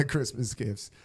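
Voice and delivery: usual speaking voice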